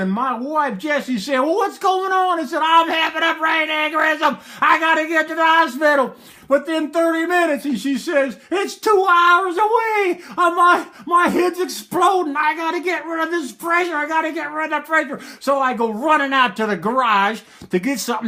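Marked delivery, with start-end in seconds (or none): 1.4-2.4 high, loud alarmed question; alarmed wife shouting. Retold or imitated. Wife shouts back in panic scene. 2.6-7.6 high, loud panicked shout; screaming. Retold or imitated. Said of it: "and I'm screaming". 8.5-10.2 high, loud, drawn-out yell; alarmed wife yelling. Retold or imitated. Wife yells back during emergency. 10.4-15.2 high-pitched acted panic; screaming. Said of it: "and I'm screaming".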